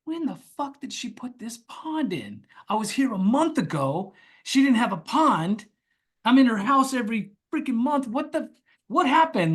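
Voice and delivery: high, rushed confusion; stunned thoughts about a new pond, voiced high; frantic inner disbelief. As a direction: spill out your shocked thoughts. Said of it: "And I'm like".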